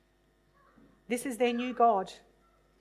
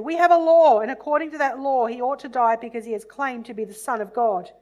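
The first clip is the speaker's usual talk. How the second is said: bursts out much louder on the opening; loud insistence; 9 dB louder than usual. Acted. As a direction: declare the law forcefully to Pilate.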